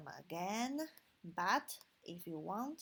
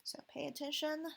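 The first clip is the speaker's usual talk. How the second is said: ordinary delivery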